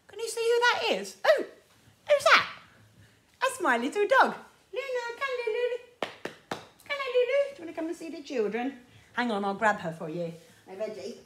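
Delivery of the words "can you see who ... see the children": high, sing-song calling; calling the dog. Real, not acted. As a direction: coax the dog over sweetly. Calling her little dog.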